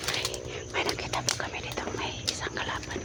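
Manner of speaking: hushed whisper near an enemy. Real, not acted. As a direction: whisper low so nobody hears. Enemy spotted nearby.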